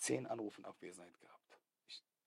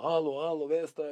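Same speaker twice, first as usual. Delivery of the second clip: higher, louder call through a window; calling across from the cell window, raised and high; 6 semitones higher, 12 dB louder than usual. Retold or imitated. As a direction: shout up to an unseen neighbour cell.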